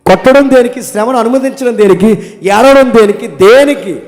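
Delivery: high, loud sermon questions; fiery preaching. Acted. Pastor pressing the church with loud, high questions. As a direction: hammer each question at the crowd.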